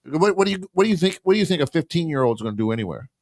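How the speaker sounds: mock her with quick rhetorical questions; lightly sarcastic dad to his daughter. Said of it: "I'm like".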